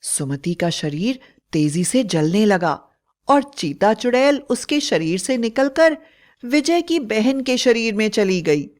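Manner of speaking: plain reading voice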